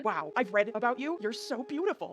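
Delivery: put-on high eager kid voice; excited little girl. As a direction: starstruck tiny child. Tiny child fangirling.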